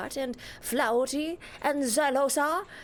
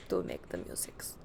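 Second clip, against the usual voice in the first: very soft, whispered aside; 11 dB quieter than usual. Pretend. Streamer promising chat more music, whispered. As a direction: drop right down into a whisper.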